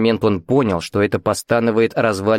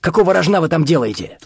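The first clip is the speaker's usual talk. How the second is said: indignant; 7 semitones higher than usual. A narrator's reading. Испуганный Виктор возмущённо окликает старика.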